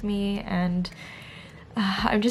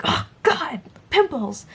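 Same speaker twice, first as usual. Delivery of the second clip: higher, louder disgust; disgusted inner voice; 6 semitones higher, 4 dB louder, slower than usual. Retold or imitated. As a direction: recoil at the mirror. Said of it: "this is how I sound in my own head".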